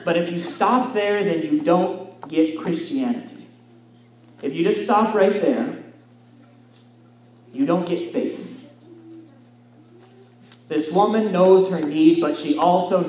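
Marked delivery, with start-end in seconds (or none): none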